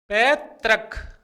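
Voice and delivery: पैतृक said very slowly, the ऋ clear; slow careful pronunciation. Shown as a demonstration. Teacher slowly demonstrates पैतृक for students. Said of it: "इस तरह से".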